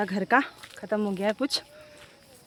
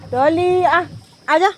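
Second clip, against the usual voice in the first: raised, higher-pitched call to a dog; 5 semitones higher, 6 dB louder, slower than usual. Real, not acted. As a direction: call the dog over loudly. Calling her dog over.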